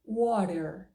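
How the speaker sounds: the "correct" American water, slowly. Shown as a demonstration. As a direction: stretch "water" like a US speaker. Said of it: "a pronúncia correta é water".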